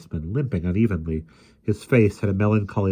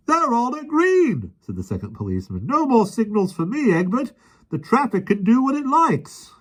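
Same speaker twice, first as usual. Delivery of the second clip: carefree; 4 dB louder than usual. A narrator's reading. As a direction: toss off duty gleefully. Second policeman carefree.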